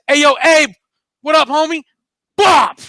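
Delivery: a high, loud mock gangster call; loud hit greeting. Booth bursts in and loudly calls out to Abe before shooting. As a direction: bark the greeting before the shot. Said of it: "He said".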